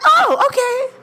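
high-pitched startled acceptance; retold polite sticker shock; taken aback. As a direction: squeak out a surprised okay. Reacts to eighteen hundred dollar price.

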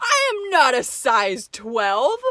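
loud, high, indignant protest; offended outburst. Real, not acted. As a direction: protest the insult, loud and shocked. Offended reply after gasp.

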